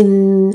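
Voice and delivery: sustained hummed "mmm"; demonstrated "mm" filler. Shown as a demonstration. Teacher repeats the advised filler, a sustained hum. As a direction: hold a closed-mouth hum while thinking. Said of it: "تمام، تقولي".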